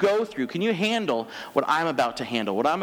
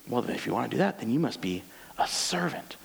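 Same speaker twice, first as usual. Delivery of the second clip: normal talking voice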